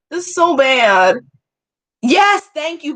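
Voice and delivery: usual speaking voice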